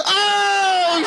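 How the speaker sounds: put-on high shouted "Oh, shit" like a startled child; kids' shocked yelp. Kids in the back seat spotting a naked man, high startled shout. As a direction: squeal it the way a startled kid would. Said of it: "They were like".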